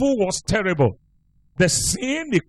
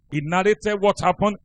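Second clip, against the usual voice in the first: ordinary talk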